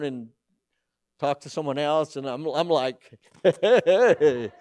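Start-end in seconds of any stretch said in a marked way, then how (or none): 3.4-4.5 loud laughing hey, ignored at church; half-laughed greeting. Retold or imitated. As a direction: burst out laughing through the hello.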